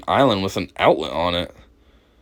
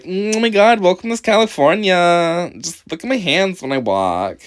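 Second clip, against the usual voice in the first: higher, showy mock welcome; mock flamboyant; 5 semitones higher than usual. Imitating the man showing off his walk, lightly higher. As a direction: show off your hands like a host. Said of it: "No, that's more like".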